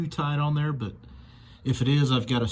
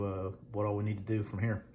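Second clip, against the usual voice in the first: normal talking voice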